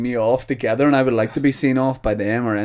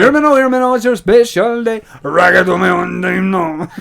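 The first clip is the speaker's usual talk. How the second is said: put-on Jamaican patois accent; 8 semitones higher, 7 dB louder than usual. Continuing the reggae MC bit.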